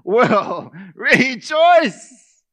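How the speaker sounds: loud, high, slow declaration; preaching Paul's vow at full force; triumphant proclamation. Acted. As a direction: ring it out with full conviction. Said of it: "He says".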